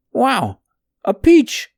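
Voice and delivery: high, bright burst of wonder; delighted surprise. Acted. Adam finding a peach, cries out in delighted surprise. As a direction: gasp it out like a sudden treat.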